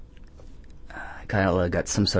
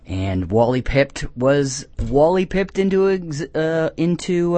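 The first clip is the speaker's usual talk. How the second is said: normal talking voice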